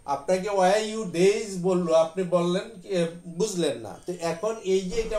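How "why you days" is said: "Why you days?" stretched out slowly, word by word; "Why you days?" dragged slow and clear; slow foreign English. Shown as a demonstration. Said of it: "আপনাকে বললো যে".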